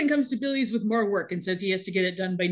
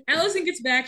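usual speaking voice

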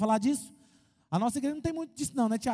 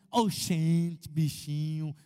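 lower-pitched mock northern Brazilian accent; 6 semitones lower, much slower than usual. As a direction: playfully imitate a northern Brazilian local. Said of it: "falava meio assim engraçado". (imitando o sotaque do norte do Brasil)

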